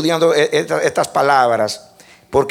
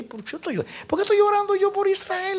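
small, high, soft questioning voice; hushed high-pitched wondering; inner self-talk; 13 semitones higher, 7 dB quieter than usual. Retold or imitated. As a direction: ask yourself softly, high and bewildered. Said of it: "Ese yo entre mí".

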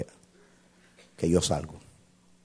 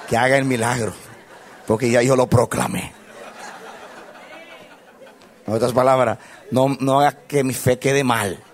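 raised, high, half-laughing plea; comic pleading; 6 semitones higher, 7 dB louder than usual. Retold or imitated. Pastor begging God to back his proclamation, loud comic plea. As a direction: beg loudly, amused at your own predicament.

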